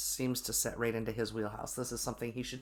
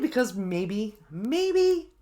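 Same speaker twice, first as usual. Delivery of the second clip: second maybe jumps much higher, dripping sarcasm; sarcastic maybe; 6 semitones higher, 4 dB louder, much slower than usual. Retold or imitated. Telling her husband she might just be frustrated: higher sarcastic jab. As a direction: tease him with a pointed, rising maybe. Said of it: "I'm feeling a little spicy inside".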